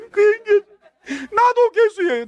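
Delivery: high, loud, slow sobbing confession; crying along. Retold or imitated. Pastor following behind, weeping and confessing loudly. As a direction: sob it out in tears. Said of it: "울면서".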